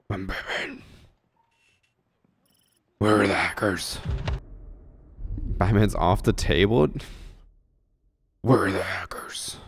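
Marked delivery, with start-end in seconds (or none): 0.1-4.0 Batman voice. Pretend. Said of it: "I could do the voice". 8.4-9.6 whispered Batman rasp. Pretend. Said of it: "don't I sound like Batman".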